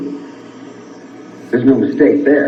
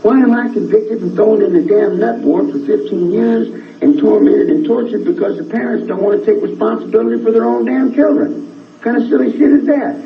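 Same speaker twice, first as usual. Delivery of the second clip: high-pitched outraged protest; worked-up rhetorical grievance, high voice; indignant tirade; 10 semitones higher than usual. Retold or imitated. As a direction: rail at the injustice, pitch held high throughout. Indignant retold tirade about blame.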